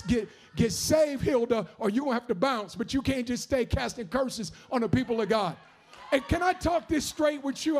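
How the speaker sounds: rising to loud shouted preaching; full-force preaching. Acted. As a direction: preach it hard, building to a shout.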